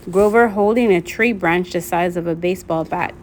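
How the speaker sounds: usual speaking voice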